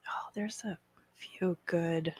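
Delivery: slow soft whisper. Real, not acted. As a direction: whisper it to yourself. Whispered aside.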